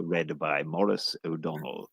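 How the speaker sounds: low, soft RP newsreader; BBC pronunciation. Acted. BBC announcer naming the newsreader, low RP accent. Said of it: "B B C pronunciation".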